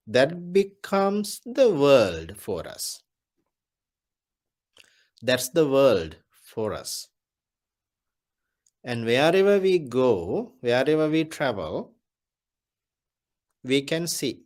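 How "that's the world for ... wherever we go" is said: usual speaking voice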